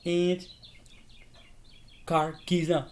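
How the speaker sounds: high, very slow put-on voice; lightly voiced robber; movie character quote. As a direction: drag it out like a carjacker. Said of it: "He's like".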